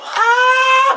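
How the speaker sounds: long, high, loud "aah"; drawn-out high yelp of tooth pain; pained howl. Acted. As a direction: howl as if cold hits a tooth. Pain of biting ice cream.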